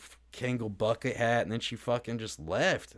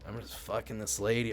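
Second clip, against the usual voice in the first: ordinary delivery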